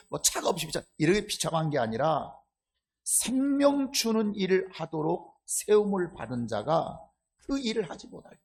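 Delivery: ordinary delivery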